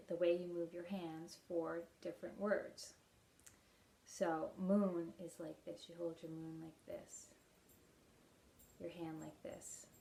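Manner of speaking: ordinary delivery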